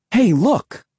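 high-pitched call to look; excited cry. A narrator's reading. Narrator pointing at birds overhead, excited cry. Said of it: "I cried".

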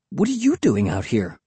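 ordinary delivery, nothing marked

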